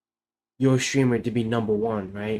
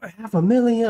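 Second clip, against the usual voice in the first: shocked disbelief; 10 semitones higher, 4 dB louder than usual. Retold or imitated. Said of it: "I was like".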